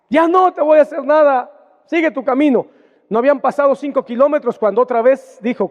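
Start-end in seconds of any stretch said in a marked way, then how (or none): 0.1-2.7 higher, louder mock-sweet call; false tenderness. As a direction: fake affection, laid on thick. Saul's insincere promise. (Saúl a David, con falsa ternura exagerada)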